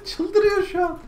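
tiz kahkahayla konuşma; gülmekten tizleşen ses; gülerek. Real, not acted. Kuş çıldırınca gülme. (kuş çıldırınca gülerek konuşma)

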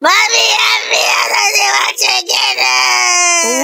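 high, crying plea for help; panicked pleading. Acted. Child haunted by film begs mommy, panicked crying. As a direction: beg mother in a high wail.

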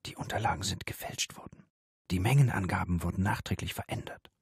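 hushed, voiceless whisper of a secret. A narrator's reading. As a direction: whisper it so nobody else hears. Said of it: "Dann flüsterte sie".

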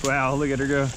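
ordinary talk, nothing marked

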